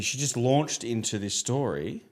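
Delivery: normal talking voice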